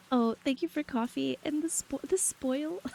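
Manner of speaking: high, giggling through the words; laughing. Real, not acted. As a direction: thank them while cracking up.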